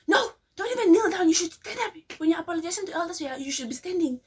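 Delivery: high, loud scolding from the elders; mock-indignant elder telling them to stand. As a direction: bark the correction at the kid like an indignant relative. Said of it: "they're like".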